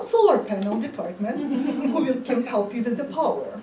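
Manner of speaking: rattled-off call center patter; fast scripted agent. Acted. The agent rushes the mouse off to another department. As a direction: speed through the script.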